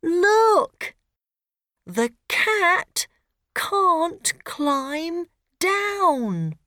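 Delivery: put-on high, slow worried girl; anxious little girl. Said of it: "Rosie worried".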